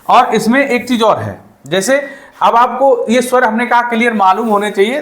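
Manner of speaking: usual speaking voice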